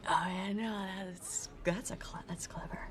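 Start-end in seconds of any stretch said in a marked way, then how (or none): 1.0-2.8 trailing whisper. Real, not acted. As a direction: breathe it out, barely voiced.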